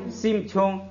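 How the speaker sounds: 심 said high with a closed m; pronunciation demo. Shown as a demonstration. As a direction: stress 심 in 심 chung. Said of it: "这个就读成".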